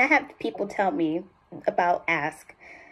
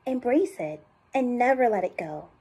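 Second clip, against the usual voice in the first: phrase read in a higher natural range; higher-voiced commercial tagline; 5 semitones higher, 5 dB quieter than usual. Shown as a demonstration. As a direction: read it as a separate heartfelt phrase, pitched high. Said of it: "Are you always high?".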